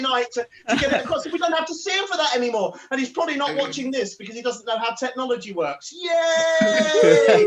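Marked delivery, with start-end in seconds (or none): 5.9-7.5 loud drawn-out cheer. Real, not acted. Host rallying the audience to applaud, cheering. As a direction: whoop up the crowd.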